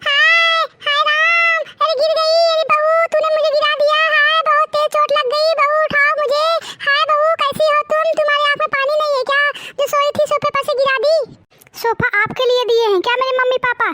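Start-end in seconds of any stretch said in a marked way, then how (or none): 0.1-11.2 put-on high old woman's wail, complaining of a fall; higher-pitched old woman howling at her daughter-in-law; wailing mother-in-law. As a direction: cry out like an old mother-in-law hurt and accusing. Mother-in-law pushed off sofa.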